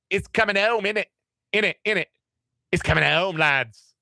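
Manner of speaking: hammed-up English lad chanting the slogan; terrible English accent. Radio host hamming it up for listeners in a bad English accent. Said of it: "Terrible English accent".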